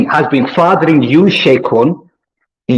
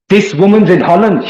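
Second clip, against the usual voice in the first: usual speaking voice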